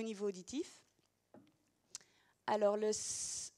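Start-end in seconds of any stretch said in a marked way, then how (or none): 2.9-3.5 "s" as a sustained voiceless hiss; isolated phoneme. Shown as a demonstration. Teacher recalling a gesture already shown, "s" held as a hiss. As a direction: hold "s" with no vowel before it. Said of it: "je l'avais montré".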